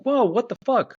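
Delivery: high, loud mock outrage; shocked protest. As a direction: yelp it in indignant disbelief. Acted shock as date storms off.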